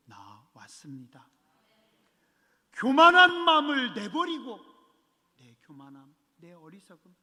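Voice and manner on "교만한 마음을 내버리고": 교만한 hit high and loud, preached; preacher raising the call to cast off pride; fervent preaching shout. Real, not acted. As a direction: cry out 교만한 마음을 내버리고 with full force. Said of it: "repeated exhortation shouted".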